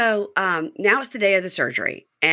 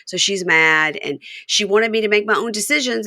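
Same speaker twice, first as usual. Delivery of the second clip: ordinary talk, nothing marked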